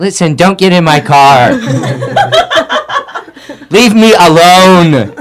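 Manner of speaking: hammed-up fright at a harmless stranger; mock scared yelling with laughs; mock panic.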